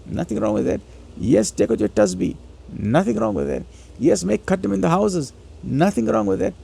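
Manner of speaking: normal talking voice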